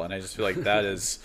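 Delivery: normal talking voice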